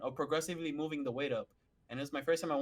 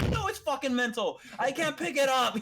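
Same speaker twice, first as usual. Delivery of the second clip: higher and louder, fed up; exasperated shout; 7 semitones higher, 5 dB louder than usual. Retold or imitated. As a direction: yell back in exasperation. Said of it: "I was like".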